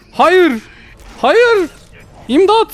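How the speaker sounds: high, loud frightened cries for help; shrieking hayır and imdat in terror; panicked screams. Real, not acted. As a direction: yell in real panic as they close in. Zombies attack, no gun.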